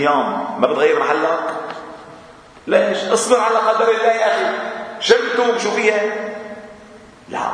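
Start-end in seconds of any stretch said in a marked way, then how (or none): 2.7-6.0 sarcastic mock voice. Mocking someone who preaches patience.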